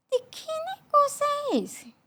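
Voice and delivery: high, slow, puzzled question; startled wonder. Retold or imitated. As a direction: ask it as if you just smelled something odd in the dark. Woken, smells perfume at night. (espanto, agudo e lento)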